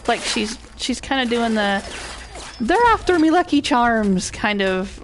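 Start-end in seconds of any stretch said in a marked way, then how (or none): 2.6-4.3 hammed-up Irish accent, higher pitched; fake Irish American accent. Streamer mocking a game character's grating accent, fake Irish. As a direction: put on a weird, fake Irish-American lilt. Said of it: "Irish American weird fake accent".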